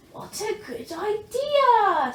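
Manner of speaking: put-on eager young dragon; Zog pleased. A narrator's reading. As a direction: stretch it out happily. Zog answers brightly.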